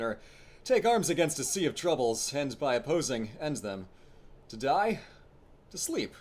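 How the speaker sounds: lightly theatrical Hamlet recital; a hint of a brooding prince; Shakespeare monologue. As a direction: declaim it like a stage Hamlet, pausing.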